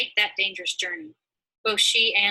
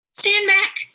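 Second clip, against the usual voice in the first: high, loud cry to back off; 13 semitones higher, 7 dB louder than usual. Acted. The women fend off two ruffians, loud high cry. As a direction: shout them away, pitched very high. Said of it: "the woman cried".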